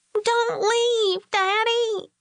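put-on very high, tearful child pleading; cling and beg him not to go; little girl crying. A narrator's reading. Little daughter clinging to her father, crying high.

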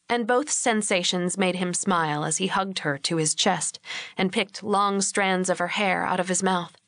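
plain reading voice

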